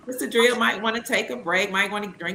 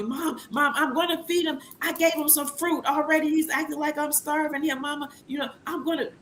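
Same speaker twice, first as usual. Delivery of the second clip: higher, softer exasperated daughter on the phone; daughter complaining; 6 semitones higher, 5 dB quieter than usual. Retold or imitated. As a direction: complain up high and hushed like a tired mom. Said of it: "my daughter will call me".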